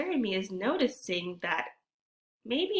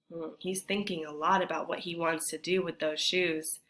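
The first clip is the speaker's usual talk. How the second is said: ordinary talk, nothing marked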